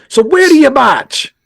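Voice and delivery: high, loud, slow put-on Massachusetts accent. George asking the teen where he marches, Massachusetts accent. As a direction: ask it like a Boston local, hammed-up. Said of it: "I can't do the Massachusetts accent".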